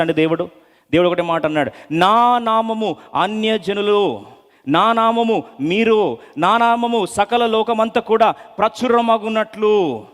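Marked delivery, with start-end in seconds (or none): 1.9-10.0 solemn declaration. Acted. Voicing God's declaration.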